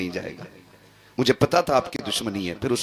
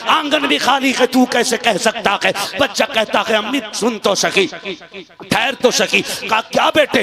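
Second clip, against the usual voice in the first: high, loud impassioned sermon climax; chanted, high and loud to the climax; full-force preaching; 9 semitones higher, 8 dB louder than usual. Acted. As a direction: preach it at the top of your voice.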